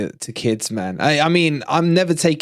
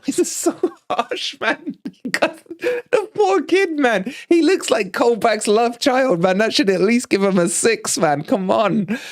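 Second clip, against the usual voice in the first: high-pitched, helpless laughing protest; laughing in disbelief; 9 semitones higher than usual. Real, not acted. Host pleading with chat for the poor kid, laughing.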